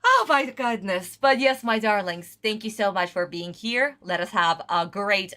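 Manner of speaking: ordinary talk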